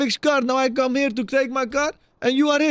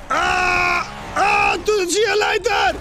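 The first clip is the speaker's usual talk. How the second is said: high shouted farewell; hammed-up yell after a departing friend; mock yell; 7 semitones higher, slower than usual. Roleplayer shouting goodbye as his friend leaves him.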